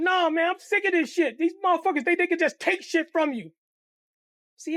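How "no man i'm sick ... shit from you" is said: higher, worked-up complaint; Kenny upset about the bike; bitter upset. Retold or imitated. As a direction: refuse to let it go. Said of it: "He said".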